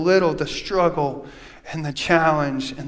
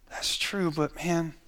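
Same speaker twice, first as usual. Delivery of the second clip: much softer, almost under the breath; hushed aside; 10 dB quieter than usual. Acted. Pastor quietly remarking on people who question too much.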